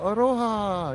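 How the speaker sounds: bright high greeting with a grin; smiling welcome, pitched well up; big smiling aloha. Retold or imitated. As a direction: beam as you say hello. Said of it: "greets him with this big smile".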